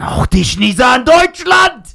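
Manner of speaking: spooky voice yelling a slow threat; ghostly shout. Retold or imitated. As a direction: burst out to scare the kids. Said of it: "Und dann diese Stimme".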